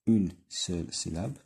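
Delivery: une seule syllabe drawn out very slowly, une stressed; slow syllable count. Shown as a demonstration. Teacher counting syllables for pupils, very slow.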